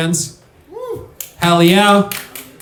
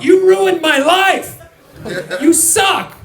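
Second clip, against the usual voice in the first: high-pitched yell of outrage; retold heckle, high and strained; heckling shout; 13 semitones higher than usual. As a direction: shout it like an aggrieved fan at the court. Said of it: "and I would shout".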